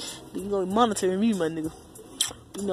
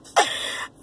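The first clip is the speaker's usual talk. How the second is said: short laugh; 4 dB louder than usual. Real, not acted. Laughs after singing.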